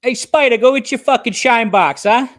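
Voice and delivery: loud, high mocking gangster jeer; Goodfellas mobster taunt. Quoting the mobster jeering Spider, loud and mocking. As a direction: bark it like a wiseguy taunting the shoeshine kid. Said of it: "that movie".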